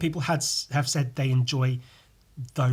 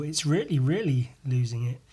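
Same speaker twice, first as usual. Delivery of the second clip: usual speaking voice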